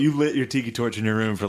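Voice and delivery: ordinary talk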